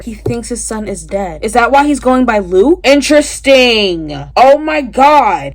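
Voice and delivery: usual speaking voice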